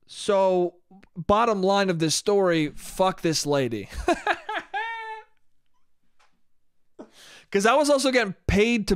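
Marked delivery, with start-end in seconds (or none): 3.9-5.2 high, soft burst of laughter; real high-pitched giggle. Real, not acted. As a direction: laugh at your own punchline. Laughs after dismissing the lady.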